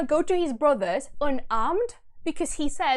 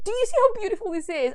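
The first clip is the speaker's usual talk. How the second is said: fast, high, loud outburst; gushing excitement; 10 semitones higher, 6 dB louder, faster than usual. Real, not acted. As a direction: gush in awe at the poetry. Gushing over the verse.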